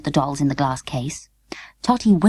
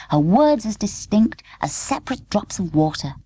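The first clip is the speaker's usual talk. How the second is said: usual speaking voice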